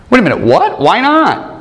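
a third grader caught off guard; mock childish shock. Preacher recalling his child self, high indignant surprise.

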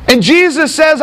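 normal talking voice

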